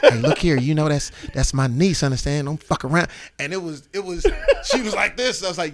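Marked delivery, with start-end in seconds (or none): 0.0-3.1 loud, laid-back gangster warning. Retold or imitated. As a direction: lean in and lay down the law, cuz. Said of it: "He said".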